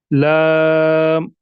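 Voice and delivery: lam stretched long and slow; slow teaching recitation of लाम; drawn-out letter name. Shown as a demonstration. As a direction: recite लाम slowly, holding the vowel. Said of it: "उसी के मुताबिक पढ़ेंगे".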